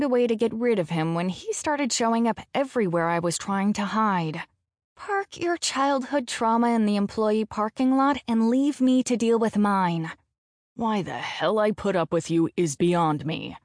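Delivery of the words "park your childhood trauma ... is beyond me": usual speaking voice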